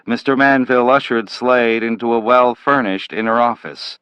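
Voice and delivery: ordinary delivery, nothing marked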